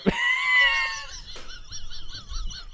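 high breathless wheeze of laughter; helpless wheezing laugh. Real, not acted. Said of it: "the wheezing laugh".

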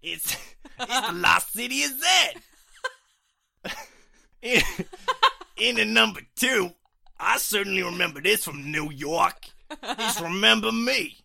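loud, high hammed-up New York accent. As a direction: belt it out as a cartoonish New York boy. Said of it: "shall I do a New York accent".

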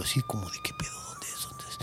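whispered search; breathless whisper in the woods. Retold or imitated. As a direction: hush it, searching for the sound. Man searching for crying woman.

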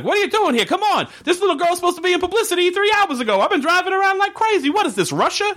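very high, badly faked accent; weird accented character voice. Gail scolding about a late publicity girl, weird character accent. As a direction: rattle it off in a high, put-on character voice. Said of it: "this accent that I can't do".

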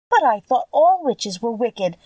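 put-on a girl nervously questioning; a touch of fright in a girlish voice; half frightened. A narrator's reading. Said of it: "half frightened at facing a real witch".

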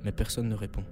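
measured suspense storytelling with long pauses; horror story narration. A narrator's reading. Co-host reading a Halloween horror story, measured suspenseful narration. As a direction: read a scary tale, letting pauses hang.

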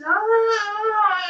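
high, open ah mimicking her release; a copied high wail of release; imitated ecstatic wail. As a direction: echo the singer's wordless cry. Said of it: "And she's just".

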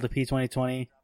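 ordinary talk, nothing marked

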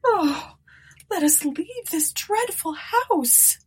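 high, slow, pleading moan. A narrator's reading. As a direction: beg to leave, moaning. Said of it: "moaned Eunice".

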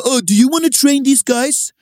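high-pitched, challenging comeback; mock indignant retort. Trainer challenging the complaining gym member, mock indignant retort. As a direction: imitate an affronted trainer throwing it back.

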